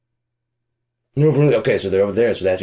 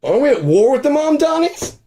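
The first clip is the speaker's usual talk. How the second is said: much higher, louder voice of a clueless bigot; 5 dB louder than usual. Pretend. Comedian mocks ignorant people hearing the name Mamdani, clueless bigot voice. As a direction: play the confused, suspicious yokel. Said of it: "I just picture the fucking people".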